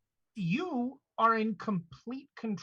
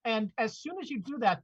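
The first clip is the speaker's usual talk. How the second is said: ordinary delivery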